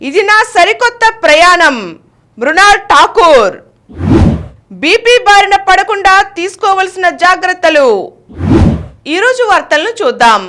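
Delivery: brisk anchor teaser read over the opening; headline read. Acted. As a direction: sell each headline like a TV anchor. News headlines read higher, louder.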